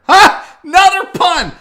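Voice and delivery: sudden loud, high crow of delight; triumphant exclamation. Real, not acted. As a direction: burst out, proud of your own joke. Crowing over own accidental pun.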